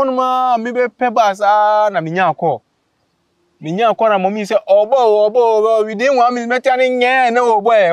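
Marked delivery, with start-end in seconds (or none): 4.7-7.9 calling out. Retold or imitated. Mother calls out the birth.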